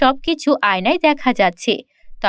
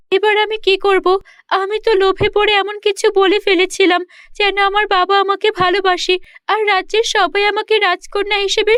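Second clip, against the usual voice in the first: put-on higher pleading voice of a worried girl; distressed princess; 5 semitones higher than usual. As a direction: beg the mirror for help, near tears.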